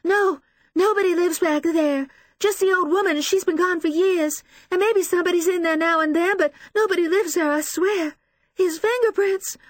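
high, loud, anguished woman; sobbing. A narrator's reading. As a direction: plead and wail through tears. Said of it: "She sobs, hugging herself".

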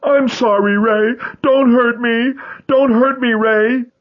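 high shrieking plea; terrified pleading. Acted. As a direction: beg in terror. Boy begging beaten by stepfather.

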